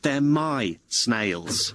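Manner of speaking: very slow, with MY drawn out; possessive, deliberate emphasis on "my"; stress on MY. Acted. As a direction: lean hard and long on "my". Asserts sole ownership of snails.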